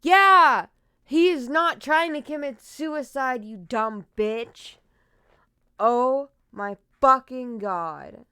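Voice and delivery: explosive anger, hammering each word; furious. Real, not acted. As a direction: shout back in real outrage. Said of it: "I'm so mad".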